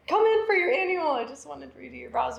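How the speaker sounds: high-pitched, eager invitation; eager coaxing. Retold or imitated. Artist calling last March's models back, eager high coaxing. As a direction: coax them in, starting high and loud.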